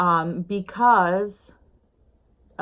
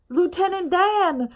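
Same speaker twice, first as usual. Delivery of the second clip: high, slow, lightly done Forrest Gump drawl; a quick playful nod to Forrest Gump; Forrest Gump impression; 11 semitones higher than usual. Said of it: "so Tom Hanks kinda sounds like Forrest Gump".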